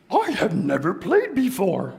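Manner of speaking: high, fuzzy puppet monster voice, a bit shy; Grover voice. Acted. Reader voicing Grover to the kids, high puppet voice. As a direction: play Grover, admitting he never played. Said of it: "letting me do the voices".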